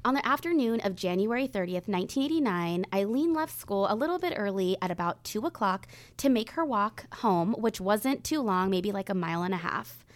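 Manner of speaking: measured true crime storytelling; suspenseful crime show narrator. Acted. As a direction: tell the case calmly, with weight. Reads the day of disappearance.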